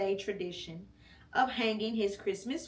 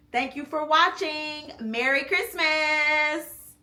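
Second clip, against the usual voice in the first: bright outro; 6 semitones higher, 6 dB louder than usual. Real, not acted. Upbeat outro to viewers.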